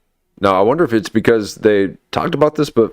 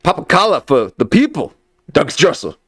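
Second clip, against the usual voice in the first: announcer catchphrase; 5 semitones higher than usual. Acted. Host's show catchphrase.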